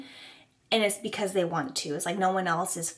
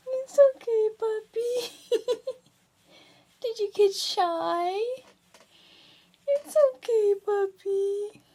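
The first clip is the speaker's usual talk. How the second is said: talking to a dog; 12 semitones higher, 3 dB louder, slower than usual. Real, not acted. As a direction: coo at a nervous puppy. Comforting the shy puppy.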